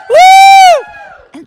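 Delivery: high, loud "woo" whoop; whooping cheer. Real, not acted. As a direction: let out a big, high crowd-rousing whoop. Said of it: "go woohoo".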